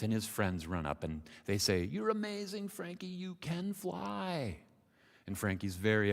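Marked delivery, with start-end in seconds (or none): 1.8-4.6 high, drawn-out cheer; amazed cheering. Acted. Parents and friends run up to the frog, cheering. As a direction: stretch the praise in a high celebratory call.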